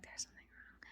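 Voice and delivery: hushed worried whisper; whispering the bad news; whispered alarm. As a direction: breathe it out in worry. Realizing daughter in trouble.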